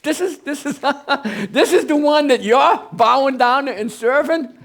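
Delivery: high, mocking laughter through the words; wife's scornful laugh. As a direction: scoff with a sneering laugh. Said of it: "his wife said".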